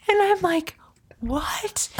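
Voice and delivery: breathy, high "What?" barely voiced; whispered disbelief.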